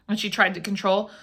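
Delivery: plain reading voice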